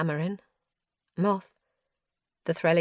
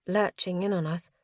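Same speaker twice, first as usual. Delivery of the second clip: ordinary delivery, nothing marked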